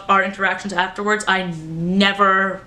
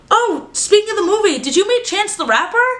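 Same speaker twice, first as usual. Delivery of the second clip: eager question; 10 semitones higher than usual. Retold or imitated. Retold own eager question.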